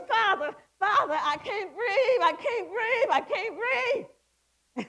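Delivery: high, loud frantic plea; acted-out scared, breathless begging; retold panic. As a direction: re-enact crying out for air, desperate. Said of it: "and I got scared".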